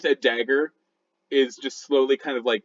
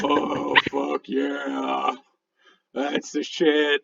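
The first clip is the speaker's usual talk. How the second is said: blissed-out raccoon; much slower than usual. Acted. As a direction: sink into it, drawn out and contented. Raccoon getting its belly rubbed.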